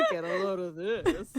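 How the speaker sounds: said through laughter; laughing. Real, not acted. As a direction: let the words ride on the laugh. Quoting while laughing.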